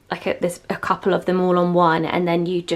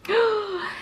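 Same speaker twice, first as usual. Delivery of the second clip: delighted gasp; 12 semitones higher, 4 dB quieter than usual. Real, not acted. Gasp on seeing the lit room.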